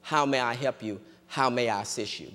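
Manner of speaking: low, softened imitation of a trained teller; lightly voiced customer-service courtesy; trained service politeness. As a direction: offer help in a practiced, low-key way. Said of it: "But their training is".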